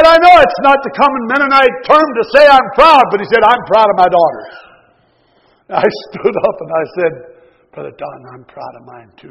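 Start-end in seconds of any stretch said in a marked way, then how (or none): 0.0-4.5 loud, emphatic preaching; forceful preaching. Acted. Preacher retelling a brother's words to the church, loudly preached. 7.7-9.3 much softer, lower, almost under the breath; said a little quiet. Retold or imitated. Preacher replying to Brother Don in church, said quietly. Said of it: "I said it a little quiet".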